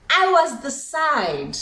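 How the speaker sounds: very slow, heavy reveal. Real, not acted. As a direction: land it slowly. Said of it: "slow reveal".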